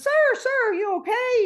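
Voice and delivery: mock call to a swimmer far out. Lady calling out to swimmer.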